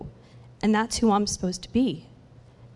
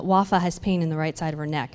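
ordinary talk

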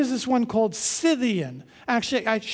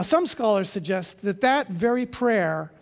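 normal talking voice

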